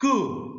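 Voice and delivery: कु with a high, drawn-out oo; long vowel. Shown as a demonstration. Teacher sounds out a syllable for kindergarteners, long vowel. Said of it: "उच्चारण थोड़ा सा लंबा होता है".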